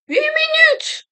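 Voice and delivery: very high squealed disbelief; startled falsetto outburst; shocked exclamation. Real, not acted. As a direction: squeal it out in shock, pitch shooting up. Shocked at the game clock.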